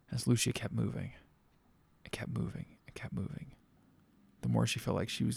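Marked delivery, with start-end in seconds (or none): 2.0-2.8 hushed repeated whisper; suspense whisper. A narrator's reading. 2.9-3.5 even fainter whisper, dying away; softer whisper. A narrator's reading. Suspense as narrator whispers.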